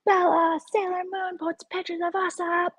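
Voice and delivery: high mocking shout of a catty woman; hammed-up high yell at Bella; mock yelling hags.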